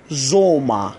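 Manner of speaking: زوما ending in an open "a", no r; final R as A. Shown as a demonstration. Teacher showing German learners a rule, زوما ending in "a".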